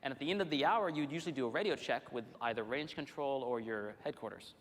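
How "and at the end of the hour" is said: usual speaking voice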